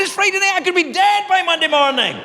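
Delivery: high-pitched indignant outburst; panicked protest. Retold or imitated. Sick caller refused until Monday, panicked protest. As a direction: protest in rising alarm, pitch way up.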